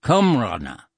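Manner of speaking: "cumrana" sounded out as kum-RAH-na; Irish pronunciation. Shown as a demonstration. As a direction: show "cumrana" carefully in three syllables. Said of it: "comes from cumrana".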